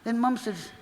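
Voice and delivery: ordinary talk, nothing marked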